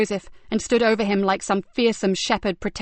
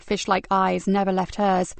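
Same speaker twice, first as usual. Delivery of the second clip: plain reading voice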